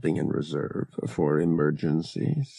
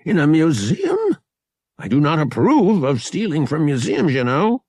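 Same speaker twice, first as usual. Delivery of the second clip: put-on lightly raised, fussy old man; a hint of a fussy old trader; old dealer voice; 9 semitones higher, 5 dB louder than usual. A narrator's reading. Narrator voices old dealer O'Connor.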